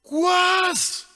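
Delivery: high, loud holler from afar; shouted call. Acted. Man high in the stand hailing a friend below, shouted call. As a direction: bellow it across a crowd. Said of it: "hy skree".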